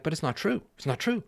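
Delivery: mildly acted disbelief, pitch climbing; baffled protest. Younger self objecting to a lie, acted bafflement. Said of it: "just being baffled by this".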